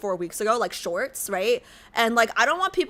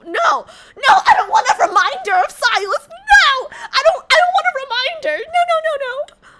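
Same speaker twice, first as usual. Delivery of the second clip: anguished wail; 7 dB louder than usual. Real, not acted. Streamer wailing at chat's reminder of Silas, high and loud.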